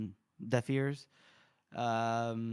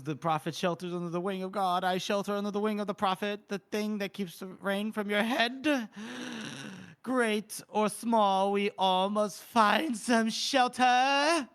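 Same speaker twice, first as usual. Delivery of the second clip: Ishri quoting scripture; 7 semitones higher than usual. Retold or imitated. Podcaster imitating a character who loves her scriptures, mock preaching. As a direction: intone it like scripture, stretching the final words out. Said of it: "Ishri does love her scriptures".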